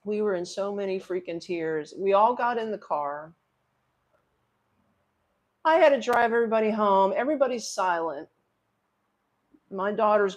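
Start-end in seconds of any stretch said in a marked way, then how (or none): none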